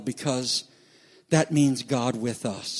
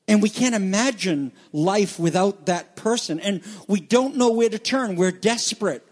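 ordinary delivery

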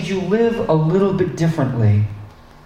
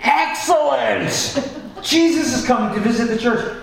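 excited congregation; 9 semitones higher than usual. Pretend. Congregation welcoming news that Jesus is coming, excited. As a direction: play a thrilled crowd, pitched way up. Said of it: "You'd all be like".

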